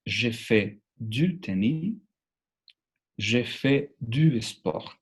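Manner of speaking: slow, careful "Je fais du tenis", "Je fais du sport"; French model phrases. Shown as a demonstration. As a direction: model each phrase slowly for repeating.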